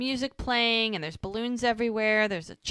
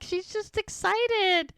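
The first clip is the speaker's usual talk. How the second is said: much higher and slow, a squeaky mimic; mock squeaky excited; 13 semitones higher, slower than usual. The speaker mimics the hostess's excitement in a high, slow squeak. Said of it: "She's like".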